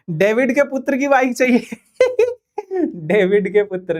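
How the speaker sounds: laughing through the words. Real, not acted. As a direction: chuckle out the joke name. Laughing at the name. (हँसते हुए बोलना)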